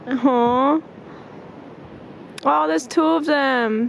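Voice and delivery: thrilled, cooing at dolphins; delighted. Real, not acted.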